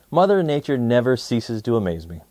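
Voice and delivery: ordinary delivery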